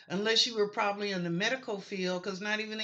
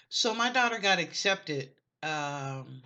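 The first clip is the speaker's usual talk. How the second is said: normal talking voice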